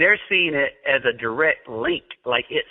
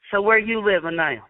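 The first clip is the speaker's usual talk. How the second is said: cousin impression; 7 semitones higher than usual. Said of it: "do an impersonation of her".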